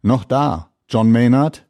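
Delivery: laut gerufene Frage übers Wasser; durchs Sprachrohr rufend. A narrator's reading. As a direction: ruf es durch ein Sprachrohr. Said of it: "durchs Sprachrohr fragt er an". (laut durchs Sprachrohr rufen)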